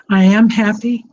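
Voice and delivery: normal talking voice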